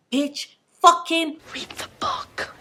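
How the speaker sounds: high raspy whisper; whispered cat voice. Retold or imitated. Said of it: "it was like".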